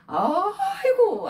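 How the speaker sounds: long, high drawn-out cry of protest; protesting wail. Acted. Brothers accused of spying making excuses, wailing protest. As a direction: stretch it into an exasperated wail. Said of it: "그러면서 막 변명을 하는 거예요".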